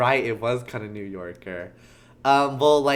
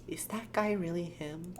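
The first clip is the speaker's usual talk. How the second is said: high, soft, unsure question; hesitant doubt; 6 semitones higher, 9 dB quieter than usual. Acted. Maya doubts the client to Nick, hesitant and soft. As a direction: ask it timidly, pitched up.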